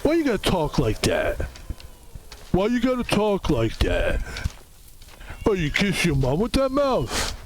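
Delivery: scolding Rocky Balboa voice with falling swoops; Rocky impression. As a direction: tell off your imitator as Rocky. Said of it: "gonna do an impression of me".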